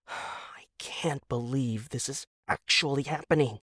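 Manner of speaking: whispered in disbelief; wife whispering to herself, worn out; exasperated whisper. Acted. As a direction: breathe it at the roof in defeat. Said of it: "exasperated sigh".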